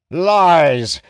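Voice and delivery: loud, high furious roar; bellowing. Acted. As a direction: bellow it at the ranger. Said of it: "the king bellows".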